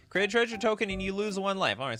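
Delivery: normal talking voice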